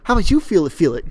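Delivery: high, loud celebrity impression; Mark Wahlberg. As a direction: egg them on in a pumped-up impression. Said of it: "that's enough of my Mark Wahlberg".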